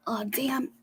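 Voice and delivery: unvoiced hushed oh damn; whispered curse. Real, not acted. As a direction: mutter it under breath. Whispered annoyance.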